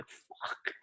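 under-breath whispered swear; whispered curse. Retold or imitated. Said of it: "I'm like".

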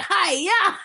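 playful martial arts "Hi-ya" with a laugh; mock kung fu yell. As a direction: shout a cartoon karate cry. Mocking kung fu kick sound.

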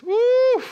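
a high, loud whoop; excited woo. Real, not acted. Preacher whooping before talking money, high and loud. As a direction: whoop it out like a cheer. Preacher cheers at his point.

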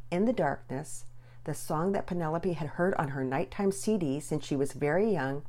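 plain reading voice

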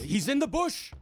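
high-pitched quick answer; high urgent hero voice. Acted. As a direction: snap it out in haste.

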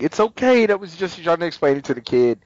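high, bright mock cheerful voice; sweet fake reassurance. Mom smoothing things over for her kid, mock sweet. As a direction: cover it up sweetly for the child. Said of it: "she's like".

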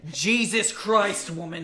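slow, loud exasperation; exasperated. Retold or imitated. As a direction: drag it out fed up. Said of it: "And I'm like".

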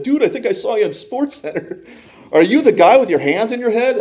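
a friend who thinks he spotted him on TV; excited friend. Retold or imitated. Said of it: "One of my friends actually called me and said".

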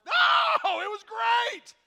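loud, high shouted cheer; big, excited insistence; emphatic encouragement. Acted. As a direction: shout down the self-doubt. Acted encourager overrides the self-doubt.

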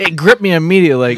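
mocking quote. Mocks the boy's formal wording.

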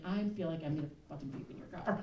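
soft, quick aside under the breath; hushed comic confession of nausea; queasy inner aside. Acted. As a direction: drop the volume and rush it like a secret thought. Queasy thought voiced mid polite questioning.

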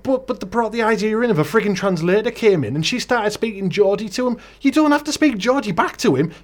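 high-pitched incredulous Geordie grumble; exasperated rant. Real, not acted. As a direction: protest in disbelief, pitched well up. Exasperated rant about Geordie translator.

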